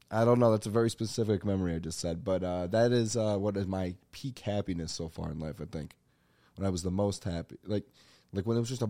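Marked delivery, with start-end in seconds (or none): none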